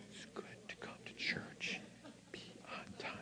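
whispered aside to the church; playful whispered repeat to the crowd; stage whisper. Real, not acted. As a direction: whisper it like a secret. Preacher whispers aside to congregation.

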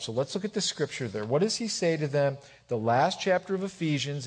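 ordinary talk

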